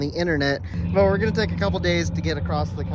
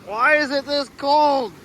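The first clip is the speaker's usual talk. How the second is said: cold and grumbling; 7 semitones higher, 5 dB louder, slower than usual. Real, not acted. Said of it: "Yeah, it ain't warm".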